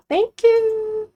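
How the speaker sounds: high, loud sugary thank-you; chirpy thanks. Real, not acted. Cashier thanking a customer, high and sweet.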